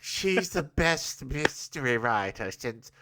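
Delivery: slow, soft mid-Atlantic drawl; old-Hollywood mid-Atlantic accent. Retold or imitated. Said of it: "That's my mid-Atlantic accent".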